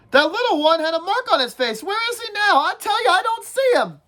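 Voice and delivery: whiny schoolboy nagging the group, pitched well up; Piggy's voice. Acted. Piggy voiced in read-aloud.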